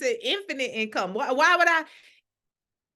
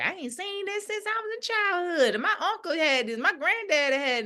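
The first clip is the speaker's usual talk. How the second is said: put-on high, wondering old-timer with a laugh; lightly voiced delighted elder; nostalgic elder; 9 semitones higher than usual. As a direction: marvel like an elder seeing childhood again.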